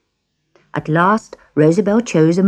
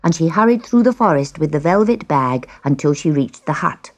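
ordinary delivery, nothing marked

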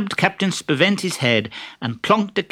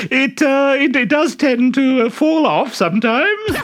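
very high, loud simpering falsetto; disguised Harlequin; 13 semitones higher, 5 dB louder than usual. Acted. Harlequin in a dress flirting with the captain, high simpering falsetto. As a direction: gush like an ugly coy bride.

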